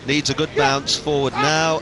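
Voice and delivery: ordinary talk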